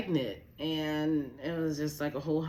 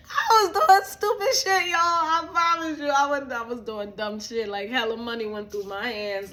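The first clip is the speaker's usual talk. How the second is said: high, loud wail through real laughter; laughing confession; 8 semitones higher, 10 dB louder than usual. Real, not acted. Admitting she wasted money, laughing high and loud. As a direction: cry it out while cracking up, gradually settling.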